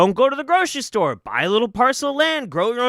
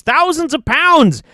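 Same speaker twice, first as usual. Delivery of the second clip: loud, rising, angry rant; furious rant; 7 semitones higher, 8 dB louder than usual. Real, not acted. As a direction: hammer it out in a fury. Ranting about falling tree trunks.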